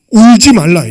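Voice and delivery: loud, firm command of Jesus. Acted. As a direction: proclaim it with full authority. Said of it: "얘기하시는 거예요". (예수님처럼 크고 단호하게)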